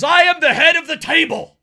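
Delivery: high, loud wrestler boast; shouted catchphrase. Acted. Host quoting a wrestling promo, shouted boast.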